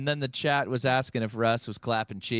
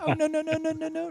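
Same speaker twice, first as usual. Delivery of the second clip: high mock echo of "oh no no no"; faster than usual. She echoes his refusal in a high mock voice. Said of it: "Repeated it back".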